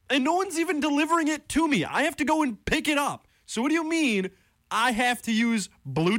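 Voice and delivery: high, indignant ranting pitch; exasperated college radio host venting about campus food; heated rant. Real, not acted. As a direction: rant in real disbelief, voice way up. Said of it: "I'm a little heated".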